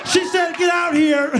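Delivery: very high, loud mock shout; hammed-up high-pitched yell; calling out insult. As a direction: holler it toward the back. Imagined Mike relaying message by shouting.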